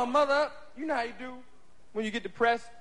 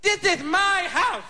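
put-on drunk father; 4 semitones higher than usual. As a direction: slur and holler at Lil from the couch. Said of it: "My pop's home like this, drunk".

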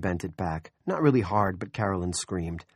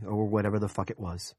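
plain reading voice

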